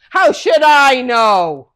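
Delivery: loud, slow, scornful comeback; rude retort. Acted. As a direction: throw the answer back rudely. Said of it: "Cain was very rude to God".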